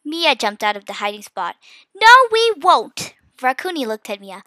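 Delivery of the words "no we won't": high, loud, slow defiance; defiant shout. A narrator's reading. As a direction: stand up to the villain loudly. Mia leaps out defying villain.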